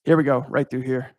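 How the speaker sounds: normal talking voice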